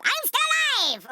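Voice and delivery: very high, drawn-out mock villain cry; hammed-up triumphant return, slow and high; Liquid Snake. Podcaster imitating Liquid popping back up, slow high crowing. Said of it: "he pops back up".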